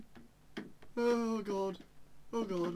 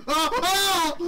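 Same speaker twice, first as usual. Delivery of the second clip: scream; 7 semitones higher, 9 dB louder than usual. Real, not acted. Jump scare scream.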